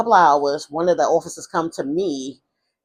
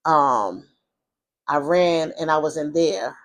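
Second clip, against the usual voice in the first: ordinary talk, nothing marked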